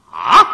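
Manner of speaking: sudden loud low shout; startled cry. Acted. Son suddenly shouting in shock at his mother's words. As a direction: jolt out a shocked yelp.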